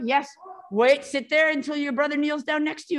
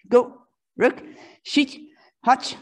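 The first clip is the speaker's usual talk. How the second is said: usual speaking voice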